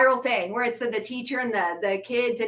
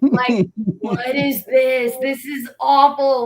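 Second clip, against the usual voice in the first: slow, loud, disgusted cry; loud and slow, reacting to a scotch that has turned awful; disgusted outburst; 5 dB louder, slower than usual. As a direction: drag it out in loud revulsion. Retold disgust at old favourite scotch.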